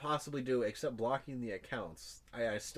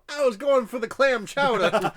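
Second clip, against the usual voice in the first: hammed-up Boston accent, higher and louder; 9 semitones higher, 12 dB louder than usual. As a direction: play a caught husband with a thick Boston accent. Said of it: "I gave it a Boston accent".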